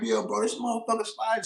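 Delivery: high, urgent retold alarm; alarmed to his buddy. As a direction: tell your buddy on the phone that the truck is sliding. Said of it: "I'm like".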